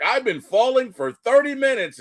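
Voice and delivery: high, whining complaint, lightly voiced; whiny Loki. Loki complaining to Doctor Strange, high whiny mock voice. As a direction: whine it way up like a sulky brother. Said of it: "Loki's like".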